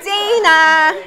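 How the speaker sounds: loud laughing shout of a name; playful loud call. Real, not acted. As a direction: call her again through a laugh. Calling out again, laughing.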